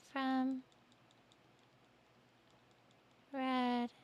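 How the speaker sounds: high, faint, halting doll voice; talking doll. Acted. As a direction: breathe each word out slowly like a dying doll. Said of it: "Is this the doll's voice?".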